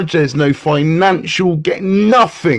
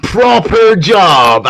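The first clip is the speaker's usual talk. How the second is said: slow, drawn-out "Proper job" in a put-on voice, each word stretched; quoted catchphrase; 4 dB louder, much slower than usual. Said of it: "as they say".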